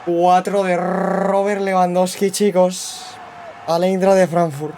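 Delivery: high, loud and slow, announcer-like; triumphant. Real, not acted. Announcing Lewandowski's fourth goal to viewers, slow triumphant call. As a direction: savour each word like a goal call.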